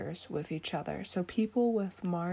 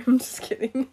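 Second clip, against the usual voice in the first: laughing; 6 semitones higher, 10 dB louder than usual. Real, not acted. Laughs off her own joke.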